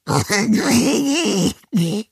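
high, drawn-out creaky vampire croak; hoarse croak. A narrator's reading. Hidden vampire hears about tea, hoarse croaking. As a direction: croak wordlessly from inside a cupboard. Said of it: "ein heiseres Krächzen".

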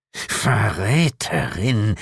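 slow, drawn-out devil's hiss. A narrator's reading. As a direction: hiss the accusation venomously. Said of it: "zischte Asmodes".

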